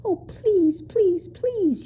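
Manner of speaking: very high, urgent pleading; begging. A narrator's reading. Lindy clings to the proc, begging. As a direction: beg in a high, imploring voice. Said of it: "she begged".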